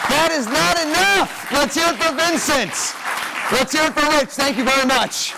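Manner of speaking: high, excited crowd hype; hyped-up emcee. Acted. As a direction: pump up the audience. Host hypes crowd for volunteers.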